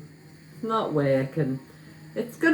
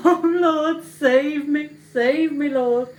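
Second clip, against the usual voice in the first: higher, louder hammed-up plea; mock plea to God; 9 semitones higher, 4 dB louder, slower than usual. Cook begs the Lord to save her failing omelette, hammed-up louder plea. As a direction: cry out to the Lord in mock despair.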